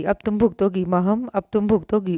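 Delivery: usual speaking voice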